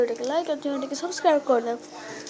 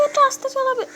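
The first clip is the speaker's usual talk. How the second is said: put-on high girlish voice; 11 semitones higher than usual. Samira accuses Raj of taking her flowers, high squeaky girl voice. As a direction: play the little sister catching a thief. Girl character asks to drive slowly.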